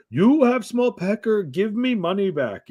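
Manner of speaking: high, slow broken-English complaint; hammed-up foreign customer demanding refund; mock angry customer. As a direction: whine like a cheated client.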